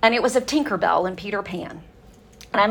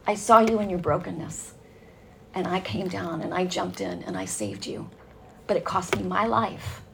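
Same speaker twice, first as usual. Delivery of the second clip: tender voice of God; 7 dB quieter than usual. Retold or imitated. And he goes.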